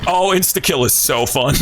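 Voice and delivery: usual speaking voice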